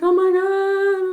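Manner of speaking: high, loud panicked gasp of a phrase; freaking out. Retold or imitated. To her parents bursting in, high panicked freak-out. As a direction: squeal it like you're scared out of your mind.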